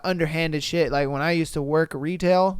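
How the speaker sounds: normal talking voice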